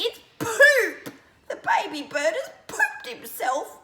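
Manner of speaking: very high loud shriek. A narrator's reading. Lizzie shrieks about the bird's mess, high shriek. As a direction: shriek in horror. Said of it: "shrieked Lizzie".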